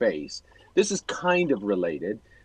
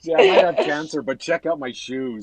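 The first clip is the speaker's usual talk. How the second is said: mock Jim bravado; 4 semitones higher, 5 dB louder than usual. Mock voicing Jim's bravado.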